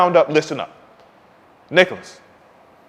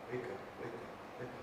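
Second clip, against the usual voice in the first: whispered; 31 dB quieter, much faster than usual. Acted. Urging the audience.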